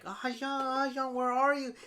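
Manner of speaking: high-pitched mock calling for him. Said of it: "she's yelling out".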